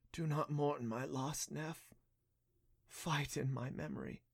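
soft, slow, dying voice; faint and fading. Acted. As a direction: let the voice fade away. Dying naiad's last words.

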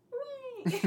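high, thin little cheer; one lone voice cheering from the back; a small high cheer from the crowd. Retold or imitated. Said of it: "there's just the one".

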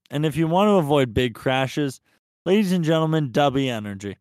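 loud announcer intro. Acted. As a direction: boom it like a game-show host. Hammed-up ad read opening.

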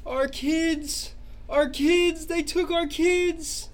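very high, loud wail, slowing at the end; sobbing cry. Acted. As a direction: grieve loudly for the stolen kids. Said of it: "starts sobbing".

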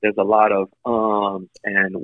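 usual speaking voice

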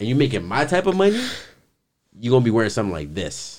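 retold condescending brag; imitated crazy boasting. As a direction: lord it over the interviewer, imitating. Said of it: "it got a little bit crazy".

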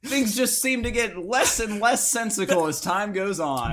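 epic game trailer narrator; trailer voiceover. Acted. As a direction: announce it like a Fallout DLC. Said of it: "That sounded like a voiceover for".

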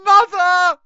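piercing high scream. A narrator's reading. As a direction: scream for your mother. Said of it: "screamed the boy".

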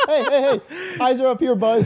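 high-pitched, laughing "eyes up here" voice; mock call-out. Friend acting out being stared at, high mock call-out. Mock telling-off over staring.

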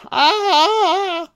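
very high, sustained fake cry; mock wail. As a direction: hold a long, high mock scream. Mimics the trapped vampire wailing.

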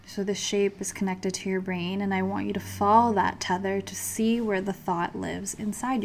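soft, calm meditation guide; guided meditation. Acted. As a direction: lead them gently into calm.

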